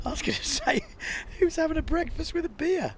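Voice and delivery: high, amused squeal of a question; laughing disbelief. Real, not acted. Laughing at misread comment.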